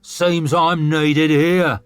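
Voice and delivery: put-on angry warrior scolding an old hand; snarled. A narrator's reading. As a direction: snarl it with contempt. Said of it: "snarled Maguire".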